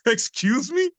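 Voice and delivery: high squeaky disbelief; incredulous. Retold or imitated. Dance teacher shocked at ten years' claim, squeaking in disbelief. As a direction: rise way up in shock.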